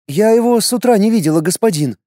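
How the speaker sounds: high, fast frightened mother; a scared mother answering quickly. Acted. Mother fearfully answers the warrior at the door, high and fast. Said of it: "испуганный голос мамы".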